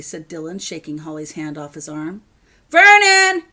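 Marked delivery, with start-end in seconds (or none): 2.7-3.4 full-voiced yell; furious shout. A narrator's reading. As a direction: bellow the name, high and loud. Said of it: "he shouted again".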